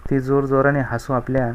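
ordinary delivery

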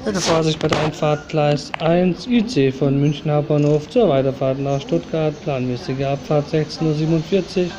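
station announcer reading train times; calm, even railway tannoy read; flat platform announcement. Acted.

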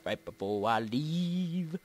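low, slow, stretched LEAVE; playful drawl. Pretend. Player dawdling before ending the video, playful drawl. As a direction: start low and drag out "leave". Playing with a repeated phrase.

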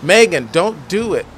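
loud plea to her friend; shouting. Acted. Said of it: "[name] shouts".

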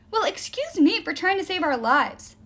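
high, indignant snap; snapping. A narrator's reading. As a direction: snap back, wounded and sarcastic. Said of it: "snapped Will".

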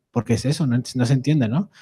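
ordinary talk, nothing marked

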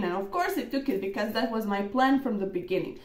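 usual speaking voice

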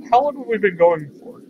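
ordinary talk

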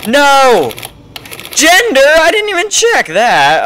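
high, loud alarmed cry; real panic at a missed check; sudden high yelp of dismay; panicked shout. Real, not acted. Realises he missed the gender check.

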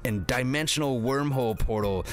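ordinary talk, nothing marked

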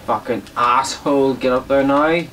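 furious yell at the game; angry outburst. Real, not acted. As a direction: snap and shout at the screen. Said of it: "I was just so angry there".

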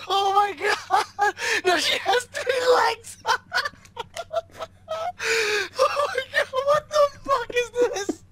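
high squealing laughter through words; shrieking with laughter; laughing shriek. Real, not acted. Friend's absurd drawing revealed.

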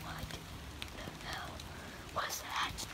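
soft, slow, nearly voiceless whisper; hushed alarm. Real, not acted. Night walker hears a noise out there, in a hushed, alarmed whisper. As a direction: whisper it, wary of whatever made that noise.

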